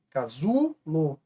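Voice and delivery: "Casulo" in slow separate syllables, ZU stretched and rising; stress on ZU. Shown as a demonstration. Teacher pointing out the penultimate syllable, slow stress on ZU in "Casulo". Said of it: "A penúltima sílaba".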